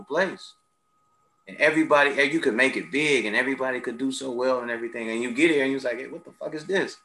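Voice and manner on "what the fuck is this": ordinary delivery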